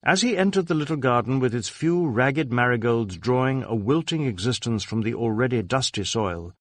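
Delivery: ordinary delivery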